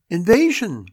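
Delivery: put-on high, loud child's proclamation; excited announcement. A narrator's reading. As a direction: announce the game with a kid's excitement. Child proudly names the game.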